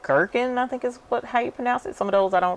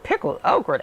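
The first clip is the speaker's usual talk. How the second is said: disbelieving question; 12 semitones higher than usual. Retold or imitated. Retold reaction to pickled okra.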